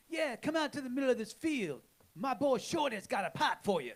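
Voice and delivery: high, drawling New Orleans street voice; put-on New Orleans accent, high and singsong; Shorty's New Orleans accent. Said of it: "Did he talk to you in that voice?".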